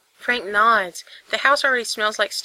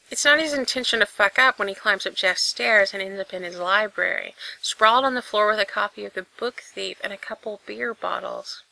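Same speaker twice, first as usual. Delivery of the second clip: usual speaking voice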